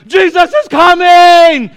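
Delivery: shrieking high and loud with joy; Elf-style squeal. Retold or imitated. Elf shouting that Santa is coming, shrill glee. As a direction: squeal like a kid at Christmas. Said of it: "He's like".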